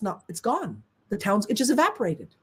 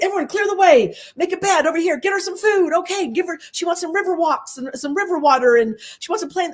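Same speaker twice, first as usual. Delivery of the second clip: higher, urgent commands to a crowd; bossy orders; 9 semitones higher than usual. Retold or imitated. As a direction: direct everyone around for the bear. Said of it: "And I'd be like".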